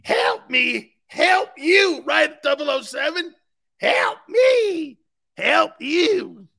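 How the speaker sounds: high, loud help me help you; mock movie plea. Mocking the hosts as the movie scene, high and loud. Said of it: "That's what you guys are like".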